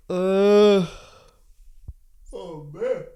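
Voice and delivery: yawning through the words; words stretched by a yawn. Real, not acted. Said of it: "I'm yawning more".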